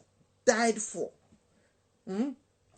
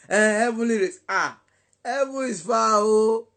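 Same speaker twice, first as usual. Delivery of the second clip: mocking song call; 3 semitones higher, 8 dB louder than usual. Preacher mocking a heavenly race song, loud call.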